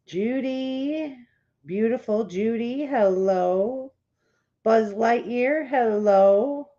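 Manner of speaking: normal talking voice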